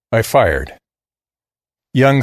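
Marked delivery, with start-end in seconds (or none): none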